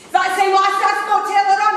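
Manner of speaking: very high, loud Coatbridge screech; Coatbridge accent. Retold or imitated. Caller quizzing the receptionist, loud high Coatbridge accent. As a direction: imitate a Coatbridge caller at full pitch. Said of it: "in her best Coatbridge accent".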